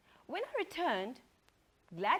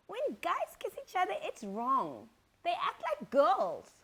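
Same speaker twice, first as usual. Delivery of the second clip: put-on higher, insistent little boy; a boy stating it firmly, pitched up; child with conviction; 7 semitones higher, 6 dB louder than usual. Son telling his mother what's wrong, insistent and high. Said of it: "with more conviction".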